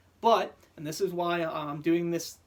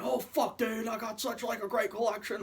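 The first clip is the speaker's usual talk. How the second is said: higher-pitched mock collector ecstasy; mocking gushing collector; 4 semitones higher than usual. Knife maker mocks collectors worshipping their knives, mock ecstatic gushing. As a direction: put on a gloating collector's swoon.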